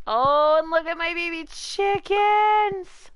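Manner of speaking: high, drawn-out gushing over chicks; cooing excitement. Real, not acted. Streamer admiring their baby chickens, gushing with excitement.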